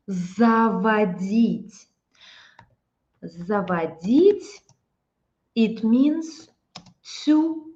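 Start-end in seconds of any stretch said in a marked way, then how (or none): 0.1-4.6 "Заводить" drawn out very slowly, syllable by syllable; slow model word. Shown as a demonstration. Teacher modelling a new word for the class. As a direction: say "Заводить" slowly so students can copy. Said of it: "Very interesting expression".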